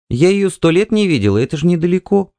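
high-pitched, eager excuse; hurried wife. A narrator's reading. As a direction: explain as a wife anxious to be off. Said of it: "Она очень торопилась". (высокий голос жены, торопливо)

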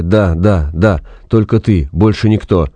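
ordinary delivery

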